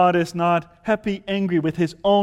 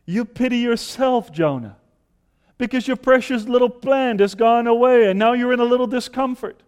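a higher, louder chiding God; God rebuking Jonah; 7 semitones higher, 5 dB louder than usual. Acted. God to sulking Jonah, higher and louder chiding. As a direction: press Jonah about his self-pity.

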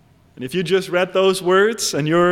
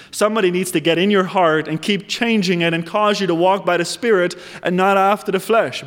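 usual speaking voice

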